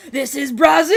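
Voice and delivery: mock shout like Vin Diesel; imitating Vin Diesel's line.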